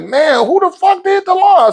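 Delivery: high mock wife voice, exasperated; a hammed-up exasperated wife; imitating wife. Wife voiced in retold story.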